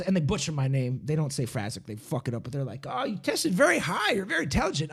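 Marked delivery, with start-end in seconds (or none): 2.9-4.9 higher-pitched mock praise; imitated over-impressed tester; gushing flattery. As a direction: flatter them like a recruiter buttering up a mark. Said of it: "they're gassing me up".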